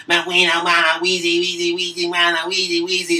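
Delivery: [name] rap voice; mock [name] rapping his way out; [name] voice. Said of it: "that's my [name] voice".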